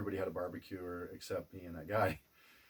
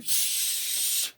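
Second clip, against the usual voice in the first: shushing; 5 dB louder than usual. Retold or imitated. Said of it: "I'm like".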